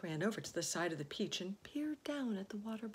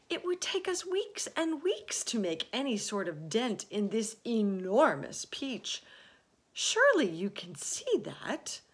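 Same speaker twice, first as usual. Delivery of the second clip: high, loud earnest reasoning; lightly voiced exasperated boy; boy James, insistent; 6 semitones higher, 9 dB louder than usual. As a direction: press the point eagerly.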